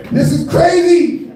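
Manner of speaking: high, stretched-out comic outrage; incredulous, drawn-out high exclamation; exasperated disbelief. Acted. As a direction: cry it out slowly, way up high. Ranting at absurd viral fame.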